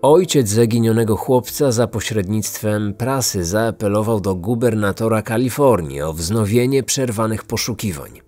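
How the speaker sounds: ordinary delivery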